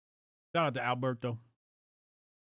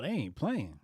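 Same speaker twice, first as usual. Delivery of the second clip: normal talking voice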